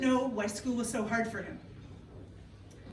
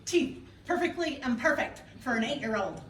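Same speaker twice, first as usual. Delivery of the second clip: normal talking voice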